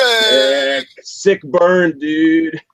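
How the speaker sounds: high, hyped-up bro voice; bro catchphrase voice. Retold or imitated. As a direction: hype it like a wrestling bro. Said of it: "Not like Zack Ryder sick".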